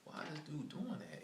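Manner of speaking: high, incredulous quoted thought; high disbelieving aside; baffled. Retold or imitated. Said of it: "I was like".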